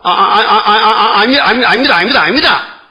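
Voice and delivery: startled Peter. Acted. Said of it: "베드로가 깜짝 놀라서".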